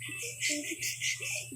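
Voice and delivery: airy laugh at own scream; breathless laugh. Real, not acted. Gamer laughing at own scream, breathless laugh. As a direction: laugh off the shriek. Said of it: "natatawa lang sa boses ko".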